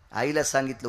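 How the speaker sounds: ordinary talk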